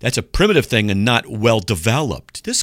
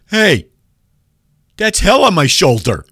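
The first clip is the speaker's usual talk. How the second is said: mock alarm; 11 semitones higher, 8 dB louder than usual. Imagined guy noticing in mirror.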